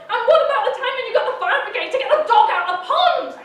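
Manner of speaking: loud, half-laughing, indignant outpouring; hysterical rant. Acted. Mother's hysterical outburst.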